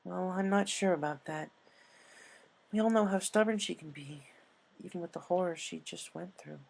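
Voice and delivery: Twilight still worrying. Acted.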